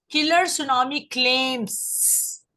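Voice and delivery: Claims said slowly, with a clear final s; slow down and land the s in claims; stress on the s. Shown as a demonstration. Teacher slowly stresses the final s for Isha. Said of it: "एस पे focus करें".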